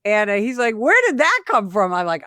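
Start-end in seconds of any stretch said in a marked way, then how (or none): 0.8-1.9 higher-pitched, incredulous question; lightly acted bewilderment, voice lifted; mock astonishment. Stunned partner asks officer about her impromptu act, imitated astonishment.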